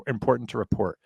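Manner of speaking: usual speaking voice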